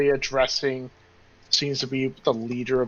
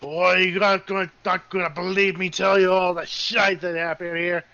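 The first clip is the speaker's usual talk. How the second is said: animated, higher character voice; Jacques; 4 semitones higher than usual. Acted. Jacques tells the newcomer of trouble, animated and raised. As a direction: launch eagerly into bad news, pitched up. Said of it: "Jacques just goes".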